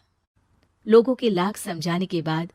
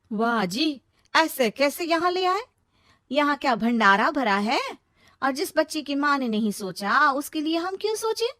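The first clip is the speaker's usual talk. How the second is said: higher, mocking indignant retort; sarcastic sister-in-law; 6 semitones higher than usual. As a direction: snap back with sarcasm. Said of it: "तभी वनिता बोल पड़ती है". (ताना मारती भाभी)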